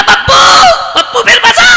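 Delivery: very high, loud punchline cry; comic exclamation. Acted. Preacher crying the punchline to crowd. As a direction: land the joke loudly.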